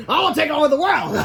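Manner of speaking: high, loud maniacal proclamation; hammed-up supervillain; evil villain. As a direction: proclaim world conquest, breaking into a cackle. Villain vows conquest, cackles.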